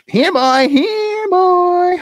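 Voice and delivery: high loud call to a puppy; calling the dog. Acted. Puppeteer calls the puppy in a high, loud voice. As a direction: call out for the dog across the room.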